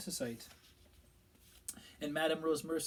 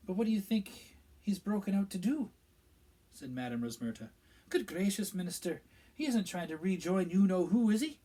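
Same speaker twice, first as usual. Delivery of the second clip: a higher, disbelieving barmaid voice; Madame Rosmerta; 6 semitones higher, 7 dB louder than usual. A narrator's reading. Barmaid gossiping with the Minister, shocked and disbelieving. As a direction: voice the landlady's shocked question.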